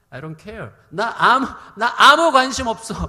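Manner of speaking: brash, loud mock dismissal; cheeky indifference. Preacher cheekily voices Jesus ignoring the storm, brash and indifferent. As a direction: play a loudly uncaring Jesus, tongue in cheek. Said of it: "예수님께 좀 건방을 떨게요".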